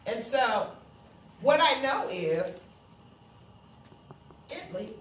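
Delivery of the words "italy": ordinary talk, nothing marked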